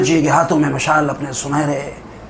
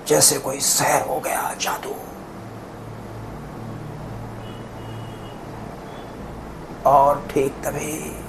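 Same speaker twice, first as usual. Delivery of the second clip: hushed wonder; 10 dB quieter than usual. Acted. Hushed recollection of a magical spell.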